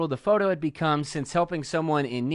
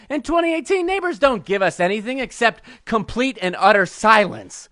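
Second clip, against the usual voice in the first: worked-up nerd tirade, often pitched up; comic rant; 3 semitones higher than usual. Pretend. Nerd ranting about a movie remake to hecklers, comic outrage. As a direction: hammer out each point in mock outrage. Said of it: "that's my rant".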